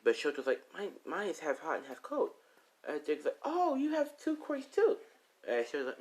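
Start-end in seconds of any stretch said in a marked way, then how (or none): 0.7-2.3 put-on child's voice. Child tells his quirk. 3.4-5.0 put-on high, slow exclamation to a child; impressed exclamation. Adult answering young Shoto, high impressed exclamation.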